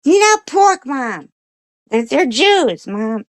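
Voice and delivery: high-pitched dog explaining to mom; squeaky doggy voice, loud at first; talking dog. Acted. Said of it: "And he goes".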